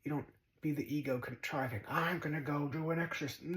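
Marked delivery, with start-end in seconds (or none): 1.9-3.6 a hint of a puffed-up would-be exorcist; lightly voiced eager contriver; mock ego. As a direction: lightly send up a self-important plan. Mocking ego's contriving.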